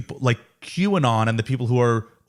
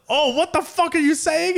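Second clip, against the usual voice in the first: much higher, slow, incredulous mock shriek; shocked reaction. Retelling the group's shocked reaction to Burke, high and incredulous. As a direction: act out the friends' disbelief, way up high. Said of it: "we're like".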